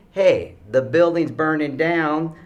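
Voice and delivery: loud, high warning, drawn out; urgent warning. Acted. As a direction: call out an alarm to the room. Calls out an imagined warning.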